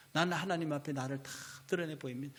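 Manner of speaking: ordinary talk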